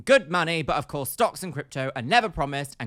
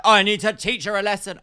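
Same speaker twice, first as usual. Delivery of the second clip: mocking the sister; 6 semitones higher, 9 dB louder than usual. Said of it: "this concept of, like".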